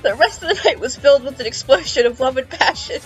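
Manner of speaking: usual speaking voice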